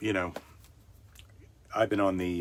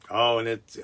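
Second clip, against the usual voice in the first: ordinary talk, nothing marked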